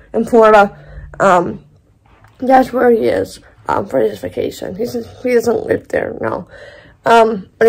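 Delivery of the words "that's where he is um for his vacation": normal talking voice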